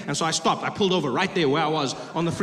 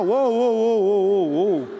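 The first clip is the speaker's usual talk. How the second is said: crowd cheer; 4 dB louder than usual. Retold or imitated.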